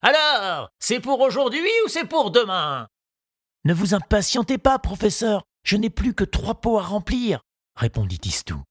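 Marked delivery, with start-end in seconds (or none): 0.0-2.9 put-on old gardener calling out from across the garden, pitched high; calling from afar. The gardener calling to Tistou from afar, pitched up high. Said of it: "lui cria de loin". 3.6-7.4 lightly voiced young boy, pitched high and eager; a boy's light high voice, keen to finish; Tistou's voice. Tistou reassuring his gardening teacher, in a high boyish voice.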